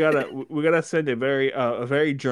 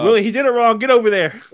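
high, loud mock scolding; mock shout; 7 semitones higher, 7 dB louder, faster than usual. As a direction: bark it like an angry boss in jest. Mock boss scolding Jared.

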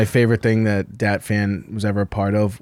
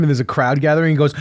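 normal talking voice

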